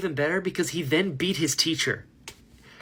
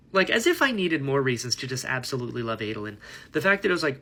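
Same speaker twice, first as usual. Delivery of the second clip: ordinary talk, nothing marked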